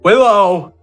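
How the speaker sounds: ordinary delivery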